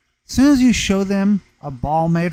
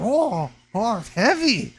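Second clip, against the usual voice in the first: higher imitated voice, caught off guard; playing dad's wife startled by the weight; surprised stepmom; 9 semitones higher, slower than usual. Dad's wife handed a heavy sphere, imitated higher surprised voice.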